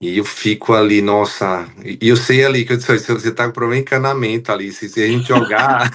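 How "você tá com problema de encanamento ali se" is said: ordinary talk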